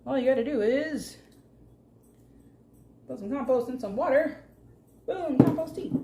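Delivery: big reveal like a TV host; game show host. Acted. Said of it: "Sound like a game show host".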